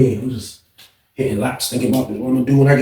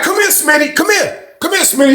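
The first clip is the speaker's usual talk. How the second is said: calling out. Retold or imitated. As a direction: call him back sharply. Lieutenant calling him back.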